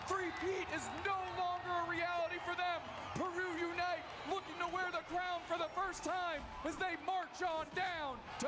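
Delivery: victory call. Real, not acted. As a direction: call the win like a final whistle. Caster calls the decisive victory.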